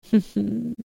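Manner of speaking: a light teasing chuckle; soft chuckle. Real, not acted. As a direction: chuckle softly at the listener. Teasing aside during hypnosis.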